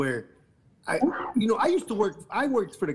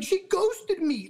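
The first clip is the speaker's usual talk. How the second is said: a much higher, slow, mock whiny voice; mocking whine; much slower than usual. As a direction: whine it high and slow like a sulking man. Mocking Piers Morgan's complaint.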